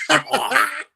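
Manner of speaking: hammed-up loud goodbye; mock exasperated yell. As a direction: holler it like you're storming out. Quitting on the team.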